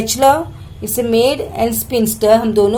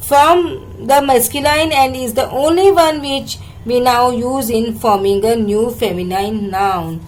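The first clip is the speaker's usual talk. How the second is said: normal talking voice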